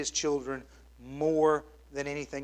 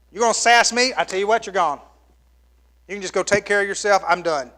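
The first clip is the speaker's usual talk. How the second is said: loud, higher, fed-up parent snapping; angry parent; 4 semitones higher, 7 dB louder than usual. Retold or imitated. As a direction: throw the kid out in mock fury. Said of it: "You said".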